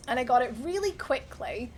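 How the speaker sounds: normal talking voice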